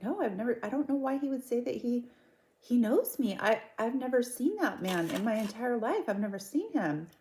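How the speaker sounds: high-pitched, insistent mock protest; protesting innocence. Wife telling police she has never seen that man, high protesting denial. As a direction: imitate a suspect protesting too much.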